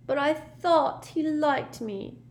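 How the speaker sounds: high, slow, hurt whine; plaintive. A narrator's reading. Myrtle about the boy who liked her, plaintive. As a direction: plead with a drawn-out wounded tone. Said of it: "she said plaintively".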